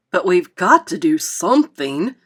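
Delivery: loud, mildly grim insistence; grim announcement. A narrator's reading. Fire chief insists that they act, announcing it grimly. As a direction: announce it with firm urgency. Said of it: "announced grimly".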